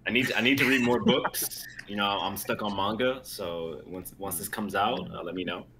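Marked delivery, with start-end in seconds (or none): none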